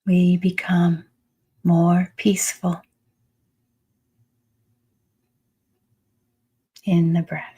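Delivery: unhurried meditation voice with long pauses; calm, spacious meditation guiding; guided meditation. Acted. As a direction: lead them into the breath, phrase by phrase. Leads a breathing meditation.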